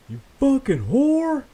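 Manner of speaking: high, loud, drawn-out holler; yelled insult. Retold or imitated. Neighbor yelling out within earshot of the door, imitated holler. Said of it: "And he yells out".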